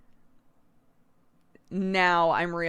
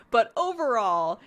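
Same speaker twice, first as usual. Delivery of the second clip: ordinary talk, nothing marked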